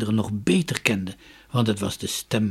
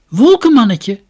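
loud, high shout of the name; calling out; 11 semitones higher, 16 dB louder than usual. A narrator's reading. Dunja calls the little cloud man, loud high shout. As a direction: shout it across the forest. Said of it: "riep ze".

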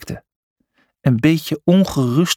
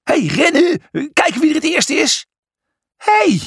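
very high-pitched race challenge; excited calling. A narrator's reading. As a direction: cry out as you dash off. Racing each other up the stairs. (hoog, opgewonden roepen)

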